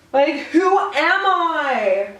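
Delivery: slow, high disbelief at oneself; incredulous. Real, not acted. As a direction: cringe at yourself. Mocking own wink at police.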